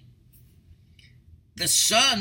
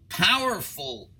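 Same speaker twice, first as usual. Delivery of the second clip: normal talking voice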